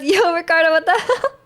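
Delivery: a touch of a high, loud yell at a driver; mock road yell. Kamina yelling at a bad driver, mock high yell. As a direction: call out a bad driver, half-joking. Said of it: "he goes".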